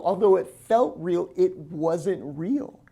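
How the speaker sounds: usual speaking voice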